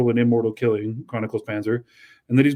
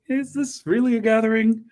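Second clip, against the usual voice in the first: mock skeptical voice; 10 semitones higher than usual. Mocking voice in retelling.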